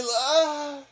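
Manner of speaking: calling out. Retold or imitated. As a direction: cry out her name. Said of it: "He's like".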